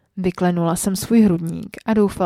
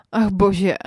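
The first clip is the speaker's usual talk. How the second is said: louder, sudden outburst; blurted exclamation; 2 semitones higher, 6 dB louder than usual. A narrator's reading. Woman overwhelmed, blurting it out loudly. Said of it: "vyhrkla jsem".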